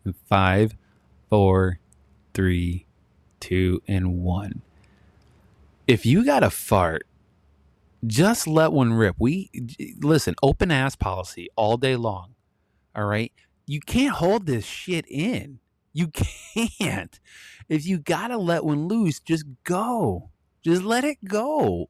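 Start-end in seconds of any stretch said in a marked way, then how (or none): 0.0-4.6 slow deliberate countdown, falling lower. Shown as a demonstration. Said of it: "give you five seconds". 17.7-21.9 high, slow, drawn-out plea; emphatic urging. Real, not acted. Urging listeners comically.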